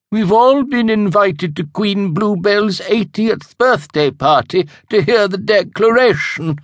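high, loud, self-satisfied boast; smug witch. Acted. Missus Pragg boasting about the royal invitation, smug and high. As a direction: show off the invitation smugly.

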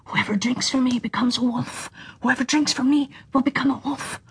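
eerie whisper of the spring; spring's whisper. A narrator's reading. Second spring whispers its warning about the wolf. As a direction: hush it like the water talking.

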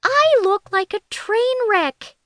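high, drawn-out cry of dismay; panicked wail. A narrator's reading. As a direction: wail it slowly in mock horror. Fiona panics at detective's arrival.

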